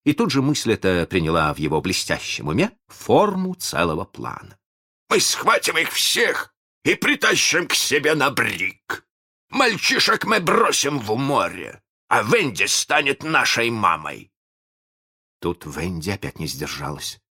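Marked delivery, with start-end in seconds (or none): 5.1-14.3 high, forceful villain declaration; triumphant pirate captain, pitched up; gleeful scheming. A narrator's reading. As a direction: proclaim the master plan with relish. Villain gleefully announces his plan.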